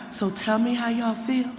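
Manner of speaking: hushed, soft call to the crowd; whisper practice. Shown as a demonstration. Mayor hushing the rally crowd for a practice round, soft whisper. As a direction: keep it down, just a practice run. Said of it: "we gotta whisper".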